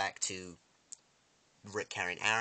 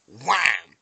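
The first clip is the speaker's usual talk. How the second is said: loud, long drawn impact word; shouted wham; 3 semitones higher, 14 dB louder than usual. Retold or imitated. Narrator acts out the stabbing blow, shouted wham. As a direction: hit it like a sudden blow.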